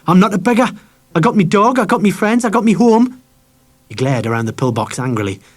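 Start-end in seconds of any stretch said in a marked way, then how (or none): 0.1-3.2 put-on much higher, loud indignant boy; flaring up. A narrator's reading. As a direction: fling each claim back in defiance. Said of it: "But Harry flared up".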